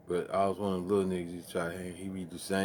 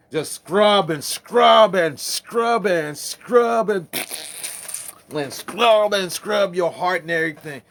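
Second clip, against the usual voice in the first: high, loud furious yell; yelling it out in rising rage; escalating shout; angry shout; 9 semitones higher, 12 dB louder, slower than usual. Real, not acted. Ranting about people using kindness.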